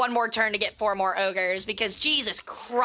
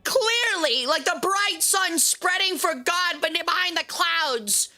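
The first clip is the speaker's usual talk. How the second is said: mock archaic lord; 6 semitones higher, slower than usual. Continues mock archaic speech.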